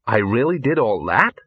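hesitant question, rising at the end; uncertain. Acted. Ginger learning what she did, uncertain. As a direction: ask it doubtfully, unsure of yourself. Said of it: "said Ginger uncertainly".

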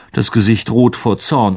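ordinary delivery, nothing marked

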